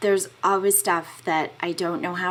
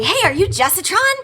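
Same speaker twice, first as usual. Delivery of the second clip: excited question; 10 semitones higher, 5 dB louder than usual. Retold or imitated. Stranger greeting her in street.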